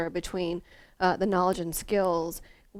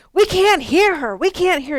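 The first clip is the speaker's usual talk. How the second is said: put-on complaining kids; 10 semitones higher, 12 dB louder than usual. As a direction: call out to the teacher like annoyed kids. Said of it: "the kids were like".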